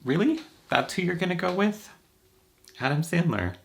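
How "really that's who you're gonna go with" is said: high, disbelieving mock friends; incredulous friends. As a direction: scoff at the crush choice, pitched way up. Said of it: "everyone that I knew was like".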